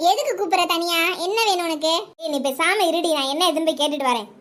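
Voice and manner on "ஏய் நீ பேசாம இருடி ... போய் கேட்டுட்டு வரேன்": ordinary talk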